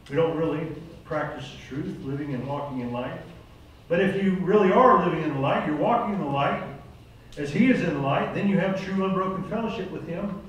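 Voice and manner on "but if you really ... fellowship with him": louder preaching climax; rising preaching. Acted. Preacher urging the congregation toward the light, louder. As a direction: drive home walking in the light.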